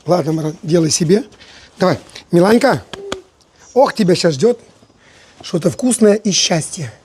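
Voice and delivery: normal talking voice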